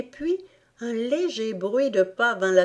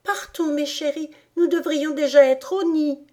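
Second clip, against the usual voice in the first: high, anxious mother bird; high, uneasy call; trembling canary; 8 semitones higher, 4 dB louder than usual. A narrator's reading. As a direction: hurry the children home, scared. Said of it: "d'une voix qui tremblait".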